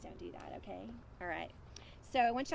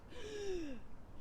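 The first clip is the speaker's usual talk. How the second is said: deep breath in; 10 semitones higher, 8 dB quieter than usual. Acted. Rooster filling his lungs before crowing, a big gasp. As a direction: suck in air to crow. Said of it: "He took a deep breath".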